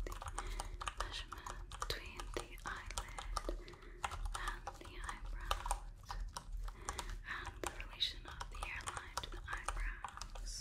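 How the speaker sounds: hushed, barely audible measuring notes; ASMR whisper. Acted. Examiner measuring eyelid to hairline, very soft ASMR whisper. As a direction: whisper each measurement softly to yourself.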